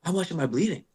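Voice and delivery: high-pitched worried question; mock anxious asking. Anxious patient acted in story.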